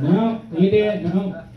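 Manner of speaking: usual speaking voice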